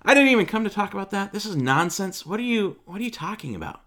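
high, hurried mock outrage; indignant protest. Nicodemus dismissing Jesus's words as nonsense, indignant.

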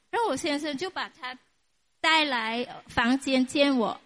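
normal talking voice

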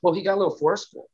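normal talking voice

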